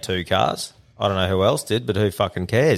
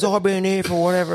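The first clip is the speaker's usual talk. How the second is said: mock indignant neighbour; 8 semitones higher, faster than usual. Neighbour claiming long residence.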